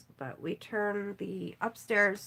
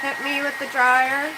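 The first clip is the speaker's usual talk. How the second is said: louder, playful, rising on dryer; playful shout; 5 semitones higher, 12 dB louder than usual. Pretend. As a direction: call it out playfully. Playful repeat to chat.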